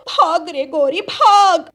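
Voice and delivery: loud, rising cry to run away; frantic loud call to run; panicked yell. Acted. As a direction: shout her into fleeing. Scared bird urging flight.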